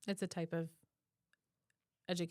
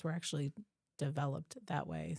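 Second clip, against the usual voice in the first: normal talking voice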